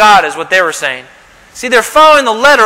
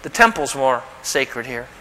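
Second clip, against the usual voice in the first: much softer and lower; Jesus calmly correcting the Pharisees; gentle correction; 7 semitones lower, 8 dB quieter than usual. Retold or imitated. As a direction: quietly set them straight. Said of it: "Jesus is saying".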